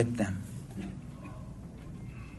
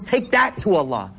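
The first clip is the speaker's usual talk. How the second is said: normal talking voice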